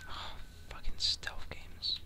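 slow, grumbling whisper. Real, not acted. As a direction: whisper the complaint under your breath. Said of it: "I feel like I have to whisper".

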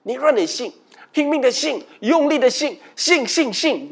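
hammed-up hype about believing harder; mocking wrong reading. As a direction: parody the pump-yourself-up believers. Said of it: "他们解释错了。".

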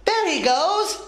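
high, excited storybook yell; mock yell. A narrator's reading. Tess sees the gecko escaping, high excited yell. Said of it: "yelled Tess".

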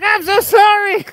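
squeaky, shouted fake sorry; high mock apology. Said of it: "And I'm like".

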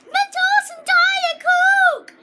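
high, slow, drawn-out product announcement; ad pitch. Acted. Kid announces his new product to viewers, high and slow. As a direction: sell it like a TV commercial.

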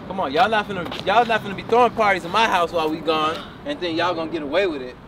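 usual speaking voice

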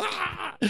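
high, short scared yelp; mock frightened scream. Timid preachers spooked by little demon, mock scream. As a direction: recoil in exaggerated fright. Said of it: "and they go".

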